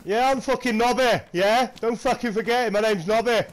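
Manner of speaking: put-on brash northern English accent character; hammed-up northern English accent. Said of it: "you do some good accents".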